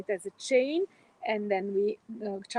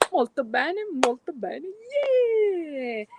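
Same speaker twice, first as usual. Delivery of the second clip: cheerful cheer; 6 semitones higher, 5 dB louder, much slower than usual. Acted. Cheering after exercise.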